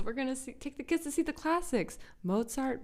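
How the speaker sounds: teasing recreation of the movie line. Retold or imitated.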